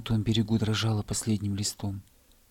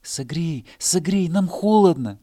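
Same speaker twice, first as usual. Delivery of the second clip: higher, louder pleas; crying out; 7 semitones higher, 7 dB louder than usual. Acted. Grass crying to the animals, loud pleading. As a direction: beg for warmth. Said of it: "кричала трава".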